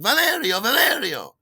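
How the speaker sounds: high, loud call of "Valerio"; a woman hailing a friend from afar; calling out a name. Retold or imitated. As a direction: call across the street to a friend. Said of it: "I was calling to you".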